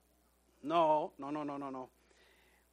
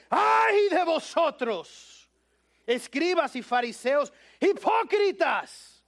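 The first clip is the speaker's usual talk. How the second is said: slow, high, loud denouncing cry; shouting Jesus; 5 semitones higher, 6 dB louder, slower than usual. Acted. Preacher voicing Jesus shouting at the Pharisees. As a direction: thunder it at the hypocrites. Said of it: "Ahí es como dice Jesús".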